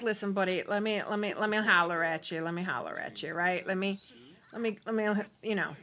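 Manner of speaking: low smooth talker. Acted.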